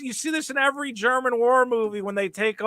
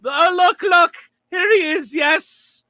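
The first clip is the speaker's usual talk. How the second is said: high, loud tattletale voice; hammed-up informant; mock eager snitch; 6 semitones higher, 4 dB louder than usual. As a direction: point him out with glee. Mock snitch pointing out Chewbacca.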